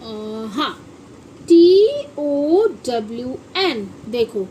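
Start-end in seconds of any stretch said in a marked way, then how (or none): 1.4-3.8 letters spelled out slowly; slow spelling. Shown as a demonstration. Teacher slowly spelling town for her pupils. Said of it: "अब इसे क्या बोलेंगे आप".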